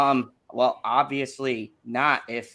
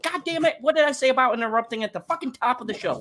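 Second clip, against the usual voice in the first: irritated outburst; 9 semitones higher, faster than usual. Real, not acted. Annoyed at being interrupted.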